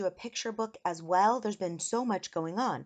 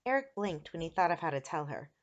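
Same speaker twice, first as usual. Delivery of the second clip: plain reading voice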